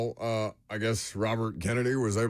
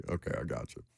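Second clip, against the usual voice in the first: normal talking voice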